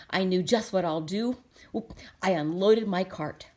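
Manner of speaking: usual speaking voice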